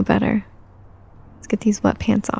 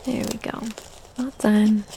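much softer, slow whisper; sleepy whisper; 11 dB quieter than usual. Acted. Mother hushing her sleepy toddler, slow whisper. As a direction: whisper slowly so the sleepy child stays calm.

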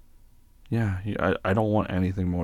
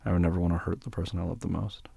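ordinary talk, nothing marked

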